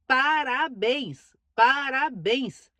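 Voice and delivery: slow, drawn-out Parabéns; drawn-out praise. Real, not acted. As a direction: stretch each Parabéns out in admiration. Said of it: "vídeos dela falando em francês".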